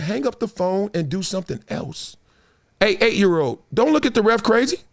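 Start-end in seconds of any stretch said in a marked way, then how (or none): none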